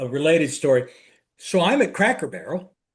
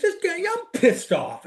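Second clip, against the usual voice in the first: higher mock-annoyed voice; brother's grumble; 13 semitones higher than usual. Brother complaining about Cracker Barrel, in a high mock-annoyed voice. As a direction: play the huffy brother. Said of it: "He goes".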